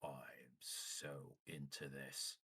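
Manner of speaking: slow, breathless whisper; whispered awe. Acted. Goblin staring at the mask, whispering in awe. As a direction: hush it out, jaw dropped in awe. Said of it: "he's like".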